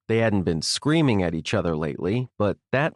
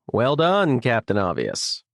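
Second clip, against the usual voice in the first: plain reading voice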